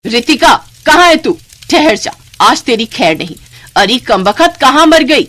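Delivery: loud, high furious calling out; angry yelling. Acted. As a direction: yell for the girl from the other room. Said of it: "Babita की गुस्से में भरी आवाज". (गुस्से में चिल्लाकर पुकारना)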